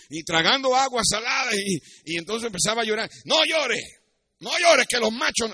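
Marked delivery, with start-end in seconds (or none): 3.2-5.5 mock stern dad. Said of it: "la frase famosa de papi".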